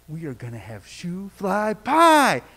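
slow build to a punched final word; proudly drawn-out big announcement, lightly acted; imitated excitement. Little boy telling his buddies about pie, imitated excitement. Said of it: "I said it with such excitement".

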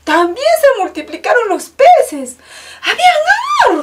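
high and loud with a laugh; joyful amazement. A narrator's reading. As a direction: marvel at the fish. Miracle climax. (asombro alegre, agudo y fuerte)

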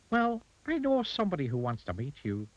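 high-pitched little goblin. A narrator's reading. Goblin to rude Mister Uppity, high goblin voice. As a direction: speak well above your own pitch, as a goblin.

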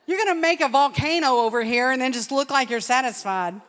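laughing jab. Real, not acted. Preacher teasing the prayer woman to the crowd, laughing. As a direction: tease her through laughter.

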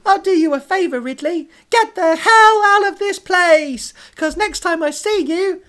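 very high, loud puppet threats; scornful squawk, drawn out at line ends; cartoon loan shark. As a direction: threaten him in a piercing cartoon voice. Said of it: "the voice is like, um, thingy from Sonic High School".

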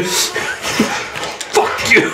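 slow, drawn-out furious curse; angry yell. Real, not acted. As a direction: spit it at the enemy. Shouldered by enemy in game.

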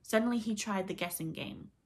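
usual speaking voice

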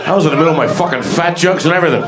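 normal talking voice

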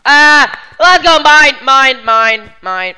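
loud greedy shouting; excited yelling. Real, not acted. Hoarding emeralds, gamer yelling.

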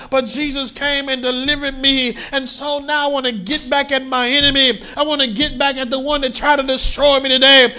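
high, loud fervent preaching; preaching at full force. Acted. Preacher fervently telling church he's crazy for Jesus. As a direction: preach it hard to the congregation.

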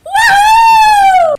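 high squealing woo; loud cheer. Real, not acted. Cheering in game.

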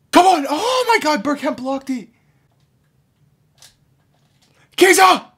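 loud, high, exasperated shouting; frustrated yell. Real, not acted. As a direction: scream at the blocked shot. Big chance blocked in game.

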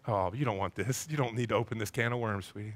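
put-on low, soft coaxing to a toddler; gentle dad. Father talking his toddler out of food, gently. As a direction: imitate a father gently putting off his little one.

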